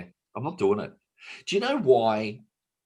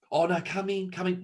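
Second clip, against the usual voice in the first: coaxing sea-as-woman; 8 semitones higher than usual. Acted. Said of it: "Just like a woman".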